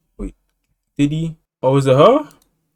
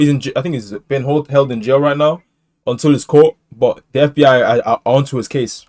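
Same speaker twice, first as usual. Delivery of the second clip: ordinary talk, nothing marked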